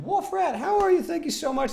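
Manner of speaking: squeaky high thank-you; high greeting. Acted. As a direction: greet them in a sweet high voice.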